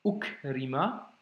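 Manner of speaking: Ukrima slow, a clean stop before the u; slow Arabic reading model; glottal block on u. Shown as a demonstration. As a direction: start Ukrima with a caught breath. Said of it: "il faut que je bloque avec le ou".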